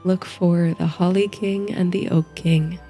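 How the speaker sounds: ordinary delivery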